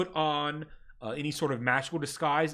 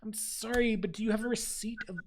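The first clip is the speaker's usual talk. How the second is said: higher, prim bureaucratic clerk voice; desk clerk; 6 semitones higher, 6 dB quieter than usual. Acted. Ministry clerk greets the ragged party, primly bureaucratic. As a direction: recite the rules like a tired official.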